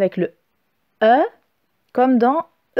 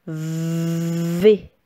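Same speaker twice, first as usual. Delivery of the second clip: syllabe seule, plus grave et plus douce; dictée articulée; 6 semitones lower than usual. Shown as a demonstration. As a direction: dicter la lettre posément. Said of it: "on va écrire la syllabe V". (voix de dictée grave)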